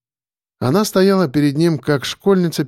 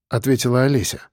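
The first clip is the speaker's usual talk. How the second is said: normal talking voice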